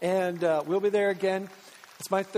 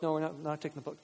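quick, softer balking; mock stubborn refusal; 4 semitones lower, 7 dB quieter, faster than usual. Disciples refusing Jesus's order, acted stubborn grumble. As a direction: refuse under your breath, digging in.

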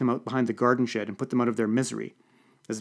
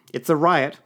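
raised-voice alert to the room; urgent announcement; 4 semitones higher, 6 dB louder than usual. A narrator's reading. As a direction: call it out loud and higher. Announcing a riot to guests.

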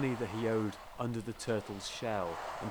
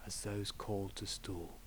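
golf commentator; 6 dB quieter than usual. Acted. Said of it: "very important to speak in hushed tones".

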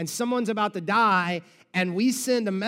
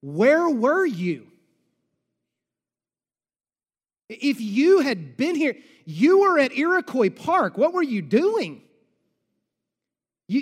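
loud hurt accusation; Martha's frustrated outburst; 5 semitones higher, 5 dB louder than usual. Acted. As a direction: plead angrily with a friend who let you down. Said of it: "and she says to him".

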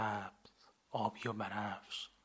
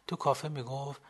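plain reading voice

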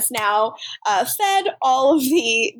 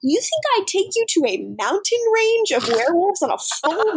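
mock Klaus outrage; 8 semitones higher than usual. Klaus scolding, retold quote.

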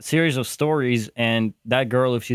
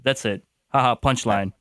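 ordinary talk, nothing marked

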